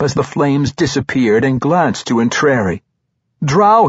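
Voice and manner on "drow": loud, high shout of alarm; urgent cry. A narrator's reading. Monk warning his ally of attackers, crying out. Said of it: "he cried".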